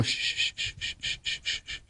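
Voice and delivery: whispered shush, hushing the son; breathy shushing to keep it secret; imitating dad shushing. Father hushing son's Broadway news, whispered shush. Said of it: "He'd go".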